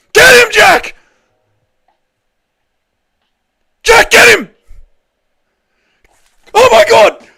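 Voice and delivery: very high, loud screams; ecstatic screaming. Real, not acted. Streamer screaming as his fighter finally lands, ecstatic. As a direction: lose it completely.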